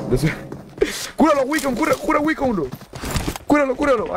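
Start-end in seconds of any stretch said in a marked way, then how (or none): 1.1-4.1 high, insistent begging; urgent pleading. Real, not acted. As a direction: urge them on in a high voice. Urging the heal.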